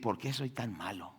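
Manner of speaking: soft guilty lament; quiet remorse. Retold or imitated. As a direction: ask it under your breath in shame. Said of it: "y decir".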